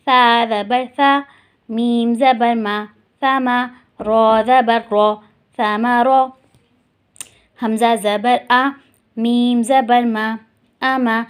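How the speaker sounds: slow, deliberate letter-by-letter recitation; slow spelling drill. Shown as a demonstration. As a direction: drill each syllable slowly for the class. (آہستہ ہجے کی مشق)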